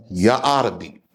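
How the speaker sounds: Ya Arabi, stress on arabi, falling; Arabic dialect. Shown as a demonstration. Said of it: "If I was to say it with the Arabic dialect".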